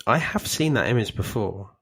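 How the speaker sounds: ordinary talk, nothing marked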